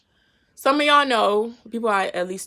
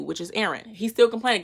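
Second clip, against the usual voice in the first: ordinary delivery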